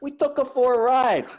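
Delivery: high, loud, laughing mock tough guy; hammed-up Italian New Yorker; Bronx wiseguy punchline. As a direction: deliver the joke with a crack of laughter.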